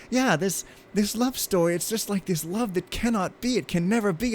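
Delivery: high, dreamy mock-melodramatic voice; mocking writer voice. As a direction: ham up a sappy screenwriter, lifted high. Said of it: "she's just like".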